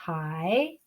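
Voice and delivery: HAI pitched low, dipping then rising; third tone. Shown as a demonstration. Teacher repeating the first character, HAI in dipping third tone. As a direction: draw HAI down and back up. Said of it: "with third tone".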